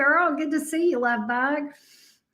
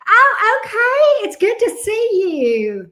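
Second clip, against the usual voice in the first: high, loud burst of welcome; delighted greeting; 9 semitones higher, 6 dB louder, slower than usual. Real, not acted. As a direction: react with real, raised delight at who just arrived. Delighted reaction to a viewer arriving.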